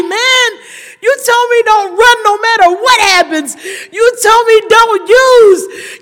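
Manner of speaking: high, loud sobbing cry. Real, not acted. Grieving sister crying out to her recovery program, sobbing. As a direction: wail it through tears.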